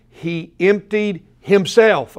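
EMPTIED and HIMSELF each set apart, slow and weighty; emphatic stress. Shown as a demonstration. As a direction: slowly hammer out "He emptied himself", word by word. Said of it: "I'm emphasizing this".

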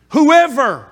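loud, rising, drawn-out sermon call; preaching at full force. Acted. Preacher urging the church to love anyone, preaching loud. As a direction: press the command home to the church.

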